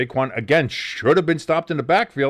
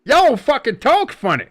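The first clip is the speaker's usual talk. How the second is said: put-on a loud, slow, high jab in a Jersey accent; mock Jersey accent; 12 semitones higher, 6 dB louder than usual. Host imitates how outsiders hear their accents, in a loud mock Jersey voice. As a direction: toss it off like a wise guy from Jersey. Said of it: "my Jersey accent".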